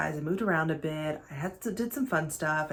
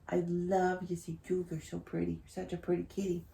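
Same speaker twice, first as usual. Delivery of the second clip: tender cooing; 7 dB quieter than usual. Real, not acted. Vlogger to her purring cat Jules, tender cooing. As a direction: murmur fond praise softly to a pet.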